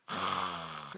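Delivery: normal talking voice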